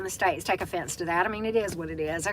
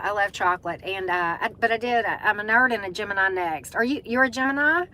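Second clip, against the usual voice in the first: ordinary talk, nothing marked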